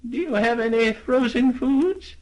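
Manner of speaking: put-on high, thin old lady. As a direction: ask like a deaf old lady. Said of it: "said the old lady".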